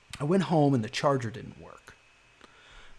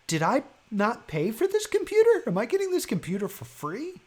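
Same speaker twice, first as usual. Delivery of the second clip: higher pitched disbelieving questions; incredulous realization; 10 semitones higher than usual. Retold or imitated. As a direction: puzzle it out in rising disbelief. Realizing card was never charged.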